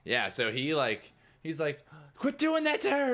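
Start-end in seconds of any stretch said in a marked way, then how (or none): 2.2-3.1 high, loud frantic yell; angry guard shout. Acted. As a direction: shout to stop the attack. Guard breaking up the fight.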